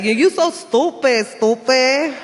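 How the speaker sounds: put-on high, loud, thick Puerto Rican New York accent; Rosie Perez accent. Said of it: "really thick Rosie Perez Puerto Rican accent".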